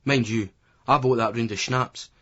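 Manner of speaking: ordinary delivery, nothing marked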